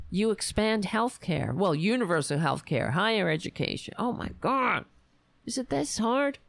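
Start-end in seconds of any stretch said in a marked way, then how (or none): 4.0-6.4 high, exasperated cry. Pretend. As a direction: plead at the sky in frustration. Exasperated outburst.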